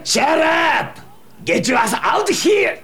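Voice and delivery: loud, high furious yell at the back room; angry shout. Acted. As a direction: bellow at the lazy assistant. Chef yells at lazy kitchen assistant.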